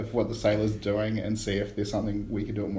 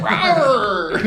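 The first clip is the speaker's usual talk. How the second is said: mocking arr; 9 semitones higher, 10 dB louder than usual. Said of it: "I mock him".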